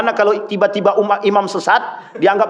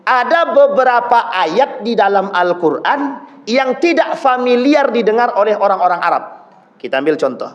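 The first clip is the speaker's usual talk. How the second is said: usual speaking voice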